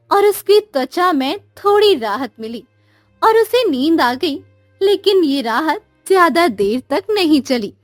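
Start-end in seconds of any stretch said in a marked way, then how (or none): none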